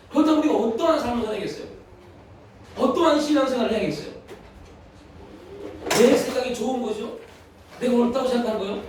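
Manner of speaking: ordinary talk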